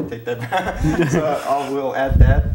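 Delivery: slow words broken by laughter; laughing. Real, not acted. Guest owning his slip to the host, laughing. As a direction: laugh it off, embarrassed but amused.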